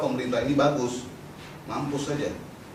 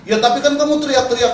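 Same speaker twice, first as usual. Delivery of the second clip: imitating interrogator, raised high voice; mock accusation; 10 semitones higher, 8 dB louder than usual. Police interrogator accusing the activist, loud mock accusation. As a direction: press the charge loudly, in imitation.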